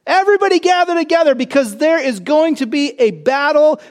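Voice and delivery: a hammed-up angel rounding up hungry birds; angel calling the birds. Preacher voicing the angel, loudly coaxing the birds to feast. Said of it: "the angel says to the birds".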